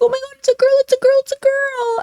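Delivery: mock her shrill excitement; mock giddy squeal. Commentator mocking the streamer's delight over the kitten, high squeal. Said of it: "She's like".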